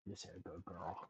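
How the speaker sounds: very soft, low praise to a dog; soothing pet praise. Real, not acted. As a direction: murmur it to the dog. Petting the dog.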